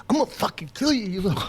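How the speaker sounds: high, strained play-threat, half laughing; mock furious threat. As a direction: threaten him like a joke gone too far. Mock threat, retold anger.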